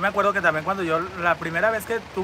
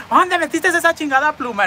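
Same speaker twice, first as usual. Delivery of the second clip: high, louder demanding yell; hammed-up furious friend; outraged friend; 8 semitones higher, 5 dB louder than usual. Friend demanding where the feather came from, high and loud.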